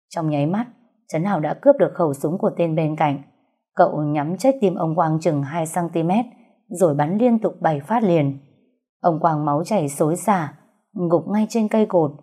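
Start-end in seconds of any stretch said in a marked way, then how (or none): none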